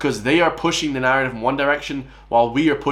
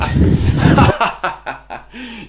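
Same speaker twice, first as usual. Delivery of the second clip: loud, high burst of derisive laughter; mocking laugh; 10 semitones higher, 6 dB louder than usual. Real, not acted. Reactor cracks up at the man in the video, mocking laugh. Laughing at the clip's masculinity line.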